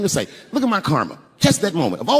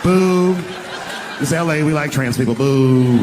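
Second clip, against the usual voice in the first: booing crowd; 3 semitones lower, slower than usual. Retold or imitated. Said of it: "the crowd was like".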